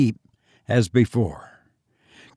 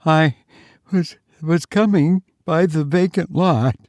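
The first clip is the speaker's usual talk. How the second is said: put-on high, halting, frightened girl; breathless with terror, pitched up; 6 semitones higher than usual. A narrator's reading. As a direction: pant it out in broken bits. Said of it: "began to speak in a breathless voice".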